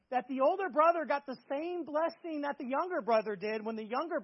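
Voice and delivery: full-voiced sermon push, pitch up and volume up; fervent preaching. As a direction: preach it with rising conviction. Raised preaching on the older brother.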